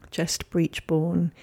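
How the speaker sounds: ordinary delivery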